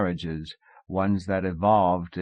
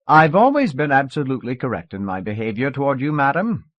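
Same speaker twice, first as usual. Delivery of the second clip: higher, louder, quick put-on English accent; mock English accent; 6 semitones higher, 6 dB louder than usual. A narrator's reading. Husband defending his conduct to his wife, English accent. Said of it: "he said in an English accent".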